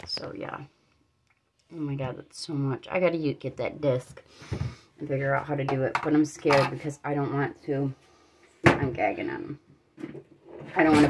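adjusting denture while talking; speaking with new dentures. Real, not acted. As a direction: lips tight over dentures. Seen 1: Fitted denture at -9; lips pursed and fingers adjusting at mouth during the words.

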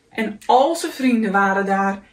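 plain reading voice

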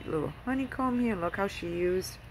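normal talking voice

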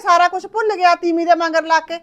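loud, high, furious complaint; mother ranting about her son and his wife; angry rant. Acted. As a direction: rail against the son.

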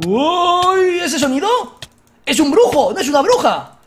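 loud, astonished exclamation; shocked discovery; surprised. Real, not acted. Surprised by the sound.

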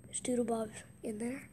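hushed whisper, peeking in. Real, not acted. Gamer sneaking near Doodlebob's house, whispering. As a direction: whisper so Doodlebob won't hear.

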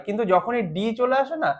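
normal talking voice

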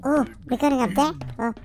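ordinary delivery

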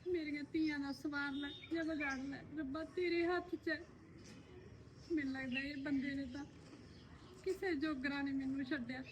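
mother quietly leaves her daughters' fate to God; soft prayer. Acted. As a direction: hand it over to God quietly. Mother prays over her daughters.

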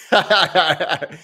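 short amused burst of laughter; real laugh. Real, not acted. Laughing at Papa Geek.

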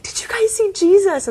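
put-on high, bright-eyed little girl bursting with news; excited child. Child runs to parents excited.

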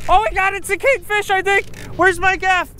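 very high, loud, thrilled yelling; high-pitched triumphant outburst; real excitement. Real, not acted. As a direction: squeal with the rush of landing it. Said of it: "I'm a little excited right now".

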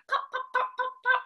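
very high little pops; playful pops. Shown as a demonstration. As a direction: pop each bubble high and light. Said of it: "playing with bubbles".

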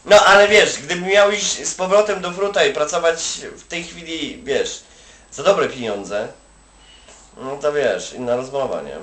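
ordinary talk

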